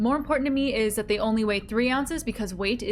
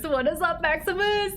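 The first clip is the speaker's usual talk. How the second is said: bright greeting; 6 semitones higher than usual. Real, not acted. Greeting a viewer.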